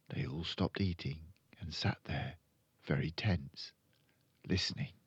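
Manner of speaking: ordinary delivery, nothing marked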